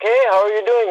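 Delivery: casual high-pitched greeting in a mock actor's accent; Gerard Butler accent. Actor greets a homeless man from his car, imitated accent. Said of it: "in that accent".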